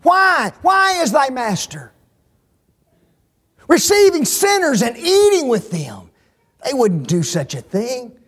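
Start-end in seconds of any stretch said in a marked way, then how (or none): 0.0-6.1 indignant Pharisee cry. Acted. Pharisees objecting to Jesus, outraged high shout.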